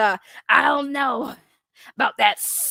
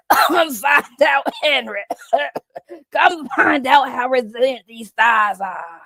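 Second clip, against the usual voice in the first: louder, laughing put-on yell; louder gruff voice with laughter; loud goblin shout; 3 semitones higher, 6 dB louder than usual. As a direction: holler the come-on at Henry. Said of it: "The Glizzy Goblin".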